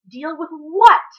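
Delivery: loud, high, slow angry snap; sharp loud demand; snapped. A narrator's reading. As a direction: bite back sharply and loud. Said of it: "Con snapped".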